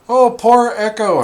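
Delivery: high, loud sympathetic coo; daughter's pity. Retold or imitated. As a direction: daughter coos over the knocked-out dog, pitying and high. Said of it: "my daughter's like".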